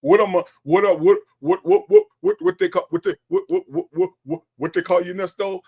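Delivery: high, exaggerated stammer, getting stuck on words; mock stuttering. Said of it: "I don't do all that stuttering".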